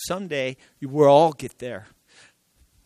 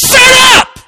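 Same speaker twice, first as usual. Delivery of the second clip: much higher and louder barked "Shut up!"; Jesus rebuking the storm at full voice; a sharp shout that cuts the translation short; sudden shout; 12 dB louder than usual. Acted. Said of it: "No, he says".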